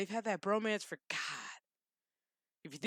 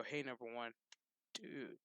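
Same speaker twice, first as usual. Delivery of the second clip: much softer, fading last words; dying Jean-Luc; 8 semitones lower, 13 dB quieter than usual. Retold or imitated. Dying captain greeting his old first officer, fading softly. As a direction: let the breath run out as he greets him. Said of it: "you hear fucking Jean-Luc go".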